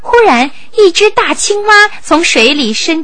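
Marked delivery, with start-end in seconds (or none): none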